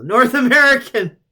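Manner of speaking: high, loud burst on the punchline; incredulous outburst. Real, not acted. Reader reaching an absurd equation, incredulous outburst. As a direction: blurt it out in amused disbelief.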